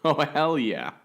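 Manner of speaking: high, loud grinning yell; gleeful shout. A narrator's reading. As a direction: shout it with a big grin. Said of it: "hear the smile in Sadie's voice".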